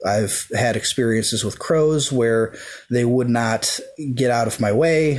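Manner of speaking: normal talking voice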